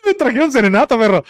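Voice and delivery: high, delighted exclamation; excited outburst. Real, not acted. Speaker hearing a serenade outside mid-recording, with real high excitement. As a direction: burst out at a sudden surprise. Reacting to music starting outside.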